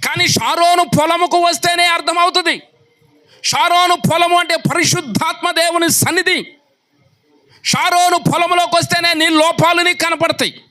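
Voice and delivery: high, driving sermon voice; impassioned pulpit delivery; fiery preaching. Acted. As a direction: rouse the congregation. Preaching at full force.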